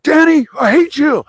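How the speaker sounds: high, mock-whiny rival griping; jealous rival.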